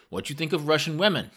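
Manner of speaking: high, quick question; loud foreign questioner. A narrator's reading. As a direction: quiz the visitor as a proud English learner. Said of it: "he spoke rather loudly".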